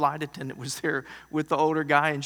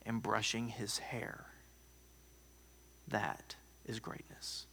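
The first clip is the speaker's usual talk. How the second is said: soft whispered close, slowing down; preacher's soft whispered punchline; hushed whisper; 5 semitones lower, 13 dB quieter than usual. Real, not acted. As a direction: drop to a whisper to land the point.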